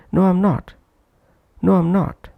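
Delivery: "I'm" reduced between a high "No" and "not"; weak-form "am". Shown as a demonstration. Teacher demonstrating the weak form in an answer, "I'm" not prominent. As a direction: keep the "am" in "I'm" short and unstressed. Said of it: "that's why not pronounced prominently".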